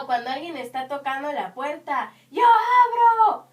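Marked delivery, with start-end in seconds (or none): 2.3-3.5 high, very loud drawn-out holler; demonstrated shout. Acted. Someone is knocking at the door, shouted call. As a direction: yell it slowly through the house. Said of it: "Ustedes ya hicieron esa intensidad".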